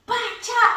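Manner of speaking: high mock smash noise; mom's own punch sound as she breaks the door; comic punch sound effect. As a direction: make the punch sound like a cartoon. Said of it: "ela fazia os barulhos dela".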